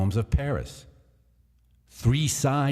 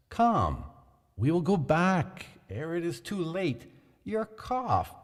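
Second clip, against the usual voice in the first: higher, mock-worried urging; feigned alarm; 7 semitones higher than usual. A narrator's reading. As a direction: sound falsely concerned.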